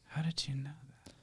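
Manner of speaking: breathy unvoiced whisper of disbelief; spooked whisper. Friend retelling a creepy AI chat, whispering. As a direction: whisper it like the app read your mind. Unnerved by an AI app's question.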